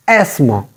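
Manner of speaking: "asthma" with a silent th; "asthma" in two syllables, s straight into m; corrected asthma. Shown as a demonstration. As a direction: say "asthma" as ass-ma. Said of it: "T H is silent here".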